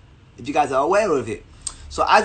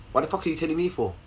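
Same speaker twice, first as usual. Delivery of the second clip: dismissive rebuff; 8 dB quieter, much faster than usual. Retold or imitated. Girl's rebuff, said low and quick.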